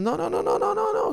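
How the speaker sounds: squeaky high, sing-song mock protest; mocking denial. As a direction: parody a smug official brushing off the question. Mocking officials' dismissive reply.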